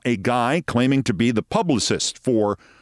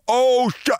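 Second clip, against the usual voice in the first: startled curse; 11 semitones higher than usual. Retold or imitated. Crew cursing as live signal drops.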